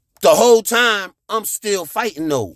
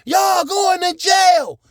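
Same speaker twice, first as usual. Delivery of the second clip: screaming threats; 12 semitones higher than usual. Retold or imitated. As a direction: scream at the kidnappers that they're going to jail. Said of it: "So I was like".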